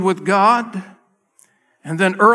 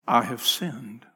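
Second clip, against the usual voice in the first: much lower, soft confession; broken confession; 8 semitones lower, 8 dB quieter than usual. Retold or imitated. Saul confessing to David, low and soft. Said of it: "Then said Saul".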